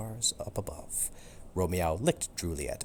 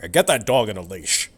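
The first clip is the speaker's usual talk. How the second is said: louder gruff officer voice, barked order; yelling order; 2 semitones higher, 10 dB louder than usual. Acted. Animal control officer ordering the Barkers, yelled loud. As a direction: shout it at the dog owners. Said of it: "he yelled at the Barkers".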